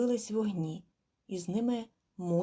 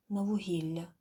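usual speaking voice

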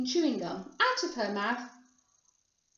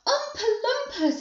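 high, loud astonished cry; amazed high-pitched outburst; everyone exclaiming; 11 semitones higher, 7 dB louder than usual. A narrator's reading. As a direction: exclaim it like a whole crowd. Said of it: "everyone said at once".

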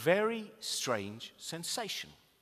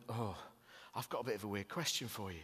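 softer, hesitant imitation of a nervous fan; 8 semitones lower, 14 dB quieter than usual. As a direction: quietly mimic his blushing nerves. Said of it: "he was really nervous".

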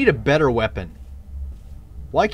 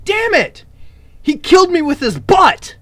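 outraged yell; 14 semitones higher than usual. Real, not acted. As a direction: blurt it out in disbelief. Hit again, outraged.

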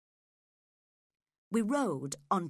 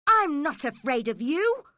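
loud, high, defiant outburst; angry cry; 7 semitones higher, 9 dB louder than usual. A narrator's reading. Cathy defies Heathcliff, crying out angrily. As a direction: shout it with defiance, pitch climbing. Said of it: "cried Cathy angrily".

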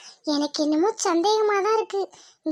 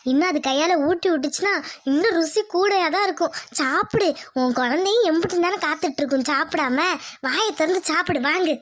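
ordinary delivery

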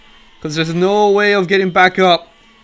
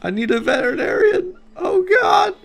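mock crying; 12 semitones higher than usual. Mock distress over injured mammoth.

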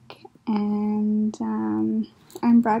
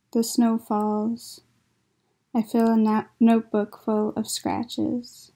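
measured, even-pitched poem reading; poetry reading. Acted. Poet reads poems about illness to online listeners, measured poetry reading. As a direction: read each line on its own, with pauses between images.

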